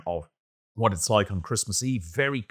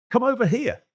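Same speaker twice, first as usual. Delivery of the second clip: put-on a much higher, far-off call in a German accent; 13 semitones higher, 3 dB louder than usual. German soldier calls to the British trench across the field, in a German accent. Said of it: "shouted in English with a strong German accent".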